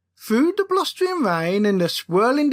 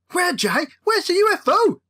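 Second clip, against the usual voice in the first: begging; 7 semitones higher than usual. Acted. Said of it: "begged Miller".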